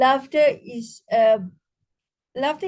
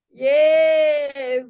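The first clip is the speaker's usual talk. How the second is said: cheer; 5 dB louder than usual. Shown as a demonstration. Cheers to end breathing exercise.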